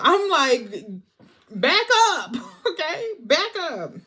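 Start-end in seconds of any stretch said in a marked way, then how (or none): 1.5-3.9 loud, high warning with a laugh in it; playfully forceful, high and loud; mock defensive warning. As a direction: push them away with a raised, pitched-up voice. Acted warning to someone too close.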